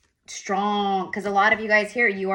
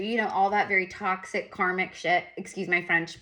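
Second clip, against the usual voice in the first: ordinary delivery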